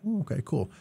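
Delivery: a caller who gets it right away; pleased surprise at the reply; surprised caller. Retold or imitated. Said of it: "caller's reaction voiced".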